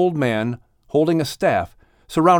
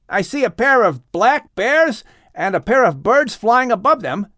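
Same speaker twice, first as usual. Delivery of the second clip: excited calling out; 12 semitones higher than usual. A narrator's reading. Ham and Japheth calling to Noah about arriving animals, high and excited. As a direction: call out to the family, eager and pointing far off. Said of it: "Ham called out".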